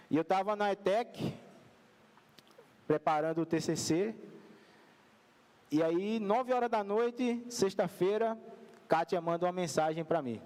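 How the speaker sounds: normal talking voice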